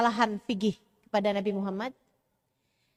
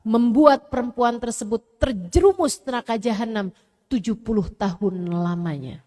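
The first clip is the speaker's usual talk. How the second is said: ordinary delivery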